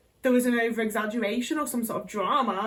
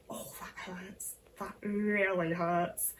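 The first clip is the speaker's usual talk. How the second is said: hushed pain; 3 semitones lower, 7 dB quieter, much slower than usual. Retold or imitated. Retelling breaking her wrist.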